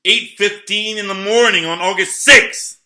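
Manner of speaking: real outraged near-shout; worked-up shout. Real, not acted. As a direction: hammer the date out in outrage.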